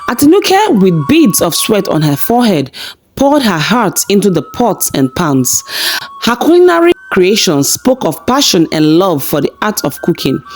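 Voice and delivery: usual speaking voice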